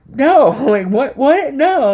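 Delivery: high, flustered protest with a laugh; retold shock, pitched high; laughing startled denial. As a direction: sputter out the denial, amused at it. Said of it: "I was like".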